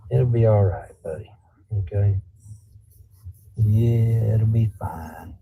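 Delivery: low, reassuring murmur to a pet; calm the dog down in a low voice; soothing. Real, not acted. Owner telling worked-up dog it'll be okay, soothing.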